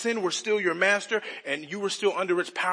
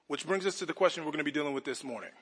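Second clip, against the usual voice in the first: ordinary talk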